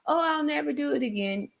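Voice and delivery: mocking abuser's promise. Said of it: "Even though they say".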